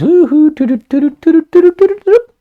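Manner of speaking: very high, loud toot-toot like a train; a playful falsetto choo-choo; train whistle imitation. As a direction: call it out like a departing train whistle.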